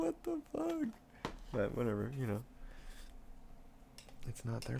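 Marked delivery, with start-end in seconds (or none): 0.0-0.9 shocked squeak. Retold or imitated. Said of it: "And I'm like".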